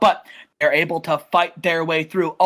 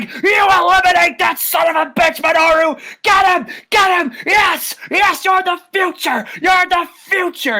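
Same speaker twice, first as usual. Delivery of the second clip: much higher, louder, yelling orders; furious cheering shout; 10 semitones higher, 4 dB louder than usual. Retold or imitated. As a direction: scream at your fighter from ringside. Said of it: "He's like".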